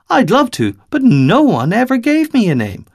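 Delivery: put-on high little girl, wistful; a child admitting she has no name; young girl's voice. Little girl telling Five nobody named her, high and wistful.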